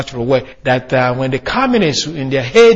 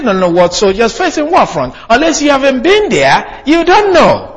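fervent preaching; 7 semitones higher than usual. Real, not acted. Preacher drives home his point.

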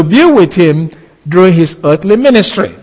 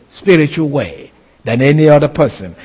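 normal talking voice

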